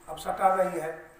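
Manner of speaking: preaching with rising force, step by step; emphatic preaching. Acted. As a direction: build the argument with forceful repetition. Preacher driving the point louder.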